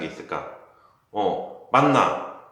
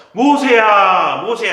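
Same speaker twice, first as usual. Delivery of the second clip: loud, slow, much higher call of Moses' name; booming call; 8 semitones higher, 11 dB louder, slower than usual. Shown as a demonstration. Teacher booming God's call to Moses from the bush. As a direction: call out across the mountain, stretched and loud. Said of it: "이렇게 불렀을까?".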